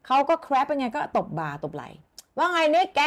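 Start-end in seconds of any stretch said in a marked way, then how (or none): 2.1-3.0 high, loud, slow jolly hello; hearty back-slap greeting. Shown as a demonstration. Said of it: "ตบที่บ่าอย่างเงี้ย". (ทักทายร่าเริงเสียงสูงดังช้า)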